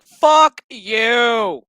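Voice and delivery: loud, emphatic rejection; mock angry retort. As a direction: throw it back hard as an acted refusal. Agnes rejects the Queen.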